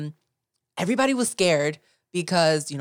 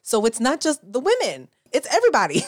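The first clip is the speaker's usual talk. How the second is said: high, loud and amazed; excited disbelief; 8 semitones higher, 4 dB louder than usual. Retold or imitated. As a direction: exclaim it like a big revelation. Retold own excited reaction.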